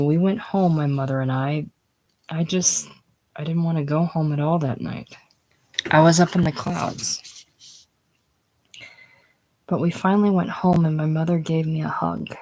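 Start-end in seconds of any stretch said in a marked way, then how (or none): none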